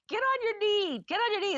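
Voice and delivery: a hint of excited relatives coaching a proposal; family coaching. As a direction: urge him on like the pushy family. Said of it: "trying to coach him through".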